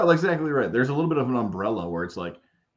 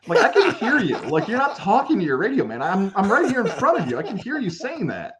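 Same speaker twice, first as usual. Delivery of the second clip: high, incredulous protest through laughter; laughing protest; 7 semitones higher than usual. Acted. Radio host to a fan insulting them face to face, incredulous. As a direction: protest as if they can't believe the rudeness.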